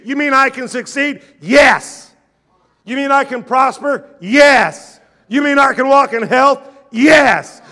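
loud, rising call and answer; preaching at full force. Acted. Preacher answering the doubts of the church, full force. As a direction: drive each yes home.

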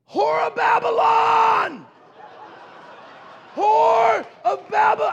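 high, slow, drawn-out shout; yelled. Retold or imitated. Preacher yelling at the woman in the store, full drawn-out shout. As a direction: bellow it across the store. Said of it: "I yelled".

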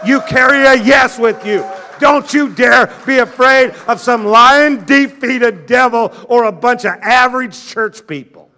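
loud, rising call and answer; preaching at full force. Acted. Preacher answering the doubts of the church, full force. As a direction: drive each yes home.

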